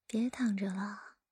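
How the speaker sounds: usual speaking voice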